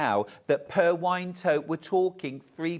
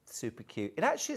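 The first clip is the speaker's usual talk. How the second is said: ordinary talk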